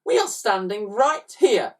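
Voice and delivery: high, loud demanding voice; demanding twin. A narrator's reading. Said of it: "added Edmund".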